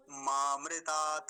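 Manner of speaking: मामृतात् slow, ending in an open ta; full final ta. Shown as a demonstration. Teacher showing it without halant, full final ta. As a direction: draw out मामृतात् and voice the last -ta. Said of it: "अगर ये हलंत ना होता तो क्या बोलते?".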